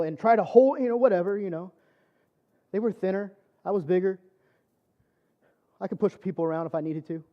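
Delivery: ordinary talk, nothing marked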